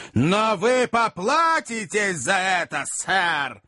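slow, high-pitched threat; menacing jealous outburst; angry threat. A narrator's reading. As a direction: promise revenge, stretching the words. Magnus threatens Pickwick.

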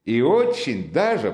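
hearty insistence; undeterred Razumikhin. A narrator's reading. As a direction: carry on cheerfully as if answered. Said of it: "продолжал Разумихин, нисколько не смущаясь молчанием".

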